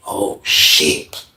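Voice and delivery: unvoiced low whisper. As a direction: whisper it in shock. Whispering as Shane leaves.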